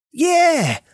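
high, loud excited yell; little boy yelling agreement. A narrator's reading. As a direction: cheer it out, jumping up and down. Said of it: "Denny yelled".

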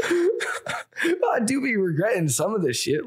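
laughing through the words; giggling, slow and high. Real, not acted. Laughing while admitting beer tweets.

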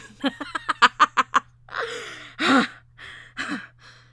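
loud, high manic cackle; long villainous laughing fit; crazed laughter. A narrator's reading. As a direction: cackle as if losing your mind. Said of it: "while she kept laughing".

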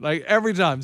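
normal talking voice

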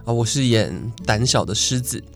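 normal talking voice

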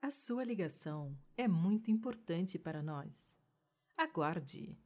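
soft recorded phone message; like an automated hold message; customer service voice. Pretend. Mock phone queue recording.

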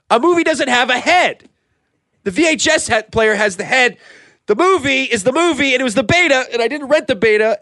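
high-pitched, agitated insistence; worked up. Acted. Movie fan objecting to a literal joke, all worked up. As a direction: argue it with rising agitation. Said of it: "I'm getting all worked up".

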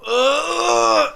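long high-pitched straining groan; demonstrated groan of extreme exertion; straining grunt. Shown as a demonstration. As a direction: push hard on a drawn-out "ugh". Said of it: "exert really hard".

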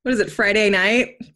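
usual speaking voice

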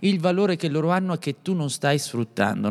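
ordinary delivery

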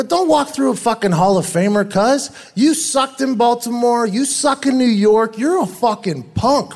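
1 retold trash talk. Retold trash talk at Bart Scott.

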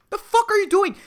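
high, loud mock outrage; exasperated yell, played for comedy; angry retort. Acted angry reply to old person.

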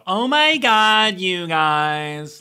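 put-on a high, loud, slow Valley Girl gush. Brits falling back on a Valley Girl accent, gushing. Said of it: "the Valley Girl thing".

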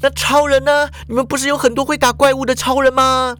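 put-on high, fast eager girl's voice; high young woman, hopeful; Shuhua asking. A narrator's reading. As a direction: press him with quick questions. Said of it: "淑华就问啊".

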